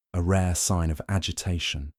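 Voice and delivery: usual speaking voice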